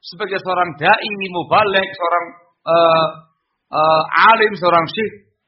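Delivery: loud, high, punched words; demonstrated stern outburst; emphatic anger. Shown as a demonstration. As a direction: stress hard like an angry cleric. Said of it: "ada penekanan di situ".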